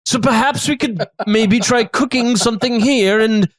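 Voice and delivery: hospitable chef, measured and theatrical; affable chef character. Acted. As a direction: explain it like a genial host in character.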